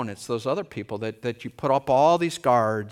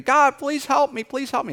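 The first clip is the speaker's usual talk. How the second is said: higher, louder pleading cry; desperate mock prayer; 8 dB louder than usual. Preacher voicing a suffering believer praying, pleading higher. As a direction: beg God like a sufferer. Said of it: "and you're praying".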